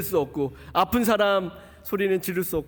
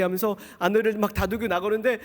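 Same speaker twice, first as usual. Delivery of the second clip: ordinary talk, nothing marked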